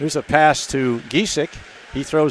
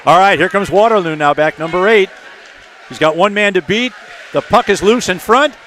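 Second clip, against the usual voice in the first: raised, energetic hockey call; live play-by-play; 4 semitones higher, 5 dB louder than usual. Real, not acted. Announcer calling Waterloo's attack and the clearance, energetic play-by-play. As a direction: keep it urgent as the play moves.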